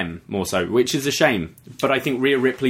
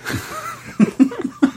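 very high burst of laughter; real squealing laugh; shrieking laugh. Real, not acted. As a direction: crack up hard. Laughing at impression.

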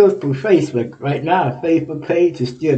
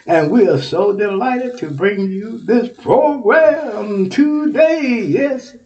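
triumphant shout; 6 semitones higher, 4 dB louder, much slower than usual. Real, not acted. Triumphant shouted self-intro.

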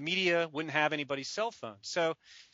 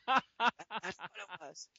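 laughing; 4 semitones higher, much slower than usual. Real, not acted. Laughing at the memory.

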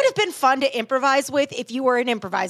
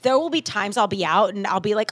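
ordinary talk, nothing marked

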